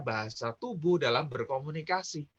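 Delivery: ordinary talk, nothing marked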